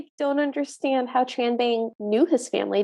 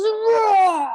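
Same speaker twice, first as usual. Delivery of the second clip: higher mock zombie roar; monster roar; 7 semitones higher, 4 dB louder than usual. Retelling the evil magistrate turning on Tranbang, mock roar. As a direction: let out a drawn monster roar. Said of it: "scary zombie face, and he goes".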